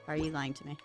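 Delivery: ordinary talk, nothing marked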